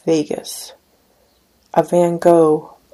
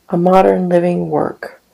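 ordinary talk, nothing marked

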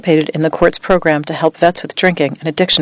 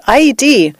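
raised, high-pitched cry, held short of yelling; narrated shout; 7 semitones higher, 3 dB louder than usual. A narrator's reading. Veteran in a nightmare flashback, shouting out. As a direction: call out in alarm, higher. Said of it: "he'd kick and shout".

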